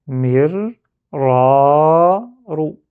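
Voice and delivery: "Mir", pause, long loud "ra", short "ro"; syllabes séparées, ra prolongé. Shown as a demonstration. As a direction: separate syllables, stretching only "ra". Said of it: "je prolonge". (« Mir, ra, ro » séparés, « ra » prolongé)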